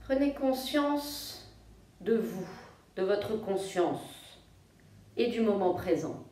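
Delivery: soft, low-key yoga nidra voice with long pauses; guided meditation. Real, not acted. Yoga teacher guiding listeners to feel their breathing, calm meditation voice. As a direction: guide them gently into rest, phrase by phrase.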